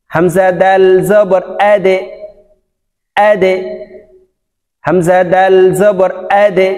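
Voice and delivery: slow spelling, "আদে" bounced down to an e; downward qalqala. Shown as a demonstration. Teacher drilling the last letter in "আদে", downward qalqala. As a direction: push the দ of "আদে" into a short echo. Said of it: "তিনটি হরফকে নিচের দিকে ধাবিত".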